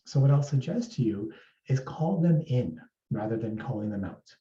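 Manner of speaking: normal talking voice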